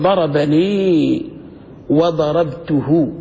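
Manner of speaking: ضربني وضربته drawn out syllable by syllable, very slow; slow careful recitation. Shown as a demonstration. Teacher models ضربني وضربته for students, very slow and deliberate. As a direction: spell ضربني out for the class slowly. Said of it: "كيف بتقول".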